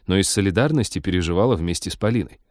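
ordinary delivery, nothing marked

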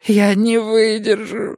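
put-on high, frantic young man pleading; desperate youth begging for help; Mikael panicking.

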